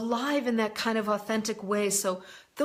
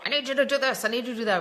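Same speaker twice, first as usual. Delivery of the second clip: raised, demanding boss orders, a bit louder; mock boss yelling; 3 semitones higher, 4 dB louder, much faster than usual. Imitating her old boss barking demands at her, mock yell. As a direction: throw out the orders like a pushy boss. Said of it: "the way this person dealt with me was always yelling".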